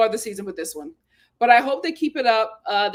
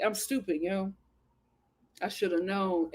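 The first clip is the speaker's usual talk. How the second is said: mock muttered regret; 6 semitones lower, 9 dB quieter than usual. Drunk guy regretting, quoted.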